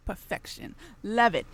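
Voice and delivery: breathy whisper while savouring; whispered perfection. Real, not acted. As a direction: whisper it like a blissful secret. Savouring candy on air.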